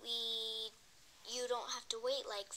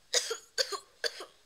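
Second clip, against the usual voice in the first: loud, hammy fake cough fit; 8 semitones higher, 10 dB louder than usual. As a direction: cough it up theatrically. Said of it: "Let's pretend coughs".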